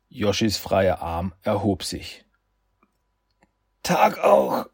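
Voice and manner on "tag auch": gasped through pain, breathless; gasping. Acted. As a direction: pant the words out in pain. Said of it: "keuchte er".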